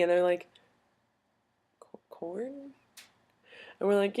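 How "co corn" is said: soft, high, hesitant question; puzzled French student. Retold or imitated. French student puzzled by English slang, soft and rising. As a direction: imitate a baffled French student trying a new word. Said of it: "the French students".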